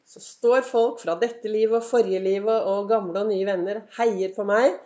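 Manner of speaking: ordinary talk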